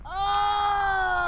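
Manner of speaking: long, high, loud yell; drawn-out shout of alarm; startled cry. Real, not acted. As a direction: cry out and hold it. Scare while playing horror game.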